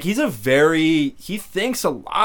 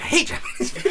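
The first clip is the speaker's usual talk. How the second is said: high, breathy, laughing mock rant; mock angry Pang; 13 semitones higher, faster than usual. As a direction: ham up a squeaky grumpy fan. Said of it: "He's like".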